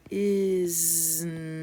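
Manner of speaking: "Isn't" stretched with long z and n, loud; sounded out. Shown as a demonstration. Teacher repeats the sounds for learners, "Isn't" drawn out. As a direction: hold each sound of "Isn't" in turn. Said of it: "Say the sounds again".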